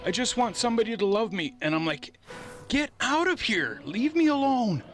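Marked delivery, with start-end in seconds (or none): 0.0-1.5 higher, fast, needy whine; mock pleading neighbour. Neighbour at the door begging him, a hammed-up needy whine. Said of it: "And she's like". 2.7-4.8 much higher, loud get-away shout; panicked yell. Retold or imitated. Shutting the door on the neighbour, a loud panicked yell.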